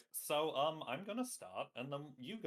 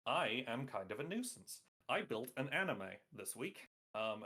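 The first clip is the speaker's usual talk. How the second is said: normal talking voice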